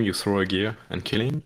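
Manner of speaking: normal talking voice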